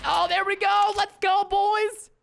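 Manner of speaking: high, loud victory call; excited cheer. Real, not acted. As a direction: cheer it out, stretching the last words. Lands a kill, celebrates.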